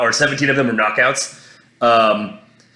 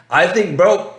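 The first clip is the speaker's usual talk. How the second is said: ordinary talk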